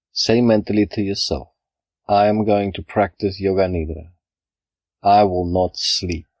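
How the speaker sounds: ordinary talk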